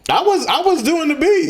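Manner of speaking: very high, loud squeal of protest; loud comic indignation; indignant protest. Real, not acted. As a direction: defend yourself in a high-pitched whine. Protests being blamed for messing up.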